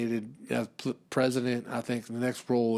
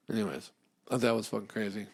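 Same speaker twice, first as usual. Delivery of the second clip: ordinary talk, nothing marked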